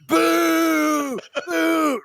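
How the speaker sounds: high mock boos from the crowd; crowd booing. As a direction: boo him like the whole line.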